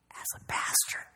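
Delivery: whispered confession. Real, not acted. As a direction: drop to a breathy whisper.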